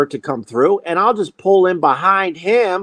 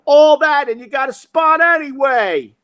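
taunting shout; 10 semitones higher than usual. Retold or imitated. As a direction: holler it to needle the other driver. Calling to driver through window.

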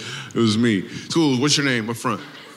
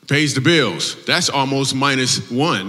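ordinary delivery

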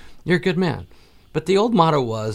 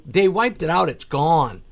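retold insistence; 4 semitones higher, 6 dB louder, slower than usual. Insisting to disbelieving friend.

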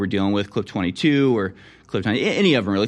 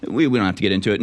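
normal talking voice

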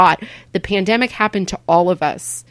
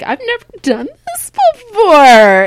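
nervous first-timer; 12 semitones higher, 6 dB louder than usual. Retold or imitated. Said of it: "You're like".

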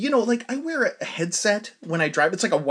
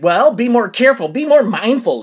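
louder, higher mock scold; old woman scolding again, louder and higher; retold yelling; 6 semitones higher, 9 dB louder than usual. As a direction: snap the scolding back at him. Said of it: "she's yelling at me".

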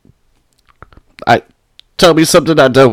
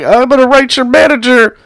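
high whiny mock voice of a complainer; 9 semitones higher than usual. Mocking the online complainers in a high whiny voice. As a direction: squeak it like an offended critic. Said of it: "People are like".

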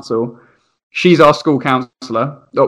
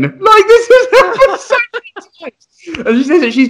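laughing mum; 3 dB louder than usual. Retold or imitated. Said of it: "the mum was laughing so much".

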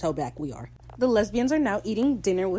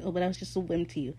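mildly acted hurt; faster than usual. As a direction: toss off the hurt without raising the voice. [name]'s take, against Steve's.

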